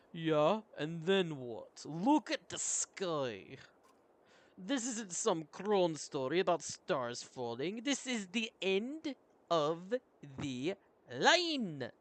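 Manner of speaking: emphatic warrior, slow and drawn out; exasperated warrior. Acted. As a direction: press the point with weary exasperation. Plays the doubting warrior.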